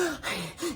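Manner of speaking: sharp high gasp after being held underwater; imitated gasp for air. Teen surfacing from the lake gasps desperately for air. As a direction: suck in air like a drowning boy. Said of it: "he's gasping for air".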